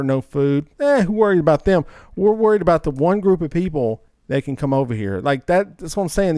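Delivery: normal talking voice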